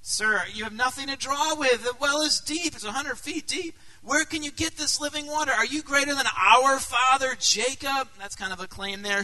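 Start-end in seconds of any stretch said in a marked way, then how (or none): 0.0-8.1 higher, disbelieving woman's voice; a skeptical Samaritan woman pushing back, higher; incredulous woman at the well. Retold or imitated. Samaritan woman challenging Jesus, higher and incredulous.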